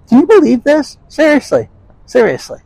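high exasperated outburst; exasperated disbelief. Real, not acted. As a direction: throw up your hands in disbelief.

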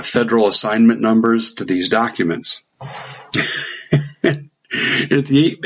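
ordinary talk, nothing marked

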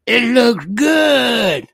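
loud, very drawn-out Southern holler; big, slow, high mock Southern exclamation; Southern affectation. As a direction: stretch it out in a hammed-up Southern accent. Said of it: "I get to read it in this voice".

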